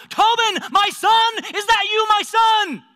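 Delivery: loud, high-pitched shout; yelled out. Acted. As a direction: call out at full voice to someone far off. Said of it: "he yelled out".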